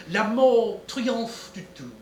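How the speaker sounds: slow, grand French proverb; French saying. Acted. Detective offering a Quebec proverb, slow and grand. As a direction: savour each French word slowly.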